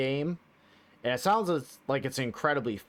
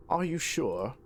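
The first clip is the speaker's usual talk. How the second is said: put-on British accent; 3 semitones higher than usual. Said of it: "kinda like a British accent".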